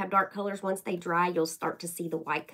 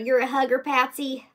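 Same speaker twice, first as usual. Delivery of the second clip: ordinary delivery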